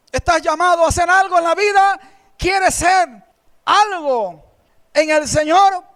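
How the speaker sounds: loud, high fervent preaching; fervent pulpit preaching; preaching at full force. Acted. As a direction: proclaim it to the congregation. Preaching to the congregation.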